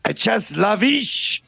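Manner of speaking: slow, hammed-up French accent. French guard ordering the cow, slow hammed-up French accent. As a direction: drawl the silly French line slowly. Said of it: "this outrageous accent".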